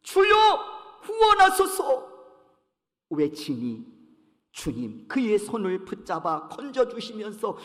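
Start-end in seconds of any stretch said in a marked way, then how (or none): 0.0-2.1 loud, high plea to the Lord; desperate cry for help. Retold or imitated. Sinking Peter cries loudly to the Lord for rescue.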